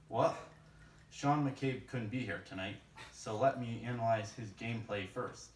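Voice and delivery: normal talking voice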